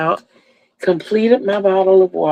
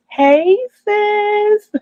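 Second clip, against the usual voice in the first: a bright, much higher call to her sister; excited greeting; 14 semitones higher than usual. Real, not acted. Vlogger greeting sister Joan as she joins, excited high call. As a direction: sing out the hello, delighted.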